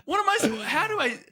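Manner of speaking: high laughing disbelief; real laughter breaking the question. Real, not acted. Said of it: "laughing retelling".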